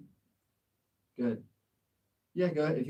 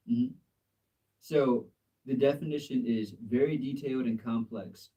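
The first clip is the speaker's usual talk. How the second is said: usual speaking voice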